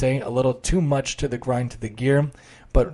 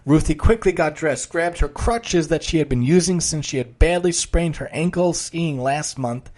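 story narration; 4 semitones higher than usual. A narrator's reading.

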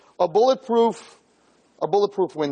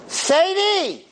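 put-on high, squawking old lady voice; 13 semitones higher, slower than usual. Old lady tourist complaining to her friend Sadie in a high voice.

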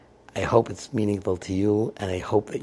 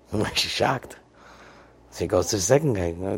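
ordinary delivery, nothing marked

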